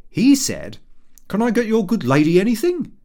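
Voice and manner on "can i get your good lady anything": higher, louder, brash tradesman voice; hearty ironmonger. A narrator's reading. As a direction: boom it out over-familiar. Hearty ironmonger offers drinks.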